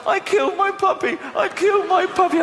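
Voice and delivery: high-pitched put-on child's wailing; mock guilty wail. Niece blaming herself to her uncle, mock wail.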